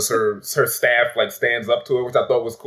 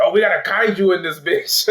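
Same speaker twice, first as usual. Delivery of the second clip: higher-pitched mock alarm; quoted exclamation; 7 semitones higher than usual. Friend retelling a favorite movie line, amused mock alarm.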